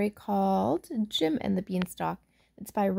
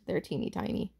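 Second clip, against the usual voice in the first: whisper; 3 semitones lower, 11 dB quieter than usual. Real, not acted. Aside to children, unvoiced.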